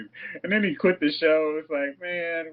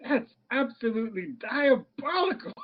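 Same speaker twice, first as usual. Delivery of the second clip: high mock co-host voice; 8 semitones higher than usual.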